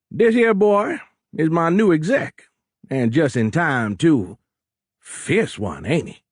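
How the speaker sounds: boisterous Cajun captain, starting high and loud; thick Cajun accent. A narrator's reading. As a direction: show him off in broad Cajun. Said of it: "in a thick Cajun accent".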